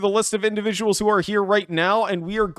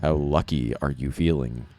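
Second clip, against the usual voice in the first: much lower, softer ominous ask; menacing dramatic; 8 dB quieter than usual. Acted. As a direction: lean in like a casino villain. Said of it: "I need to ask you this question".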